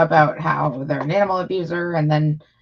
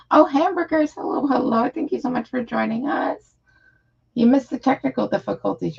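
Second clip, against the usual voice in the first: bright greeting; 7 semitones higher than usual. Real, not acted. Greeting viewers joining stream.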